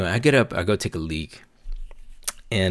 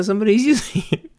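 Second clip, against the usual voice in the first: laughing through quote; 5 dB louder, faster than usual. Retold or imitated. Retelling buddy's reply, laughing.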